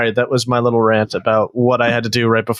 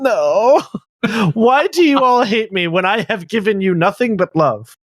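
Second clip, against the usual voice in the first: mock-wounded plea; 6 semitones higher than usual. Co-host begging to be spared math, mock-wounded. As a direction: plead innocence like a wronged child.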